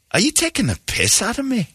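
high, indignant Scottish challenge; a Glasgow man squaring up, mock offended; Scottish accent. Scottish fan confronting John at the game, in a high indignant Scottish accent. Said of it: "And he goes".

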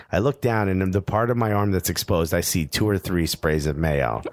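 usual speaking voice

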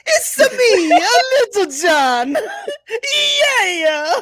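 laughing Mario-style Italian voice; Italian "it's me". Shown as a demonstration. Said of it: "Italian impression ... you start with "It's me".

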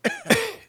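caixa feita com tosse; tosse curta de beatbox. Shown as a demonstration. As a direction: tossir seco como caixa. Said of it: "A caixa é uma tosse". (tosse seca de caixa de beatbox)

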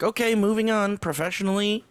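lightly voiced show wrangler; imitating Joe. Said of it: "be like".

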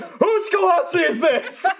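high, slow, outraged question; high indignant question. Retold or imitated. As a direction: call it out with mock outrage. Demanding who left the kolache.

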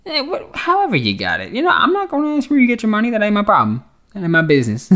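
high-pitched, quick mock disclaimer; joking put-on voice. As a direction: squeak it out as a playful aside. Joking aside on listener's money.

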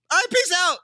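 high, loud goofy "peace out"; mock De Niro. Cohost voicing De Niro driving off, mock breezy. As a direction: toss it off like a cool dude leaving.

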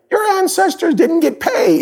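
high, lightly voiced doubter; high-pitched mock objection; mock skeptic. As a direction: toss it off like a naysayer. Voicing a skeptic.

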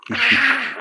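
a high excited yell of recognition; excited shout. Retold or imitated. Teammate spotting an old friend across the pitch, excited yell. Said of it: "and he went".

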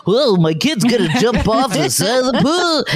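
high, goofy worry voice; silly voice. Shown as a demonstration. Mother mocking her own worry about kid at the pool, silly voice. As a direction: put on a silly, swooping high voice for an anxious thought. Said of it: "It does sound silly when I say it in that voice".

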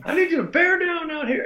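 very high falsetto scolding; piping, hammed-up coach impression; high-pitched old coach. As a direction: chew out the pitcher in a squeaky falsetto. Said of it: "in his high-pitched voice".

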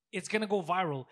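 ordinary talk, nothing marked

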